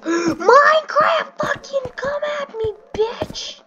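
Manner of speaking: high screaming mock challenge; gasp, then scream it high and stretched out; mock fanboy screech; hyped fan impression. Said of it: "People are like".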